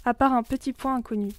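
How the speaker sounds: ordinary delivery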